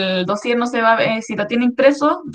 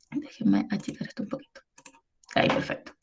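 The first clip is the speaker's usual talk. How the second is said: muttered aside; 4 semitones lower, 11 dB quieter than usual. Real, not acted. Aside while adjusting screen.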